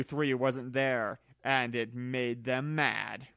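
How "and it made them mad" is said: plain reading voice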